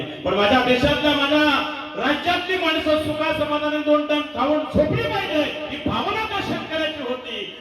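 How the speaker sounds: high, ringing preacher's cry, slowing on each phrase; preaching at full force. Acted. Kirtankar rousing the audience about the lockdown, soaring preaching cry. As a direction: lift the crowd with a soaring sermon call.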